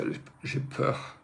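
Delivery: a slow, breathy whisper of fear; chuchoté, j'ai peur, lentement. Real, not acted. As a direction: hush it out, barely able to say it. Confessing fear in panic moment.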